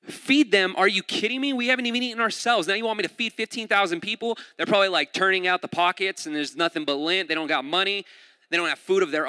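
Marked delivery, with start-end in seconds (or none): 0.0-4.4 higher, incredulous retort, acted out; freaking out. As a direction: act out a freaked-out disciple's disbelief. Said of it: "They're freaking out".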